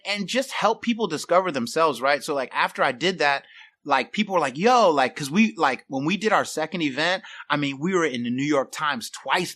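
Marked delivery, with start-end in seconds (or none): 4.6-4.9 mock call out. People calling out to him.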